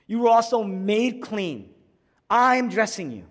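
loud, high, ringing verdict; emphatic proclamation. Retold or imitated. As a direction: declare it forcefully as the advocate. Preacher voices the advocate's triumphant ruling.

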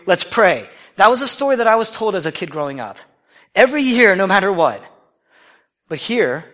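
ordinary delivery

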